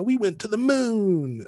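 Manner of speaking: high, drawn-out mock awe; dreamy, sing-song grandeur, put on; idealized triumph. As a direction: stretch it out high like a starry-eyed booster. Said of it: "idealize the idea of".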